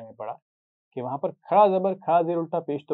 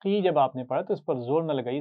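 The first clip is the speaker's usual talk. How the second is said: ordinary delivery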